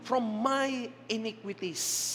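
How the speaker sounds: very slow solemn reading of the verse; slow scripture reading. Acted. Preacher reading the psalm to the church, very slow. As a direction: draw out each word of the psalm.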